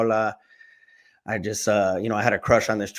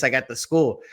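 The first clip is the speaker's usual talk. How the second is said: ordinary delivery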